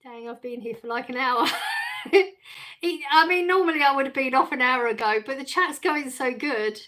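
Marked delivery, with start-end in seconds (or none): 1.5-2.8 a burst of high, loud laughter. Real, not acted. Streamer laughing at her own hours-long stream, high laugh. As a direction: laugh out at how long she's been on.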